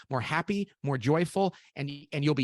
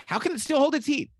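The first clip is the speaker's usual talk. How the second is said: amazed question; 13 semitones higher, 5 dB louder than usual. Retold or imitated. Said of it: "And you're like".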